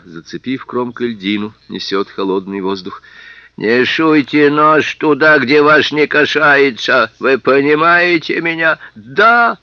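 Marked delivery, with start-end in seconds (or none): 3.6-8.8 high, loud, slow warning; slow menacing threat. Acted. Policeman threatening. 8.9-9.6 high, loud shriek. Acted. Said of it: "завизжал Сэм".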